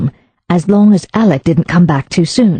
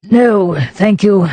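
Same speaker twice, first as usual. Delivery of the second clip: "No, thank you" said evenly and politely; measured, courteous refusal; calm courtesy; 3 semitones higher, slower than usual. Acted. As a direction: restore composure after the outburst. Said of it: "retrieved his calm courtesy".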